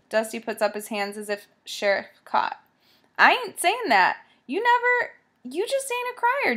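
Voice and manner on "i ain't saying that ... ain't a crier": nervous backpedal. Acted. Dusty backs down, hands raised.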